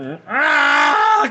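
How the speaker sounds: high, loud drawn-out yowl; sudden cry. Real, not acted. Owner crying out at the cat, loud high yelp. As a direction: let out a long loud "aah".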